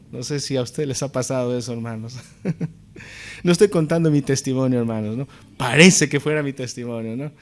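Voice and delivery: ordinary talk, nothing marked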